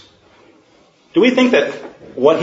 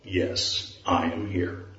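low, much softer, nearly whispered; the Lord's deep voice; 7 semitones lower, 12 dB quieter, slower than usual. Acted. The Lord answers the falling man, low and soft. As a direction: answer from above, low and hushed. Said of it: "A deep voice replied".

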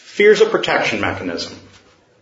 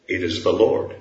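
low, much softer, nearly whispered; the Lord's deep voice; 7 semitones lower, 5 dB quieter than usual. Acted. The Lord answers the falling man, low and soft. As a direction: answer from above, low and hushed.

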